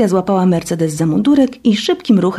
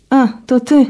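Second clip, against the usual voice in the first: dismissive; 6 semitones higher, 4 dB louder than usual. Acted. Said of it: "powiedziała lekceważąco Katia".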